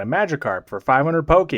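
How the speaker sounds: ordinary talk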